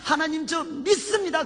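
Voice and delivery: high-pitched declaration of faith; fervent confession. Retold or imitated. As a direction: raise the voice into an emphatic vow. Same confession repeated with fervor.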